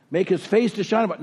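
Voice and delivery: skips past The Lord to the face line; fudged reading. Shown as a demonstration. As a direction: rush on to "Make his face". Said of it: "some of us fudge and go on".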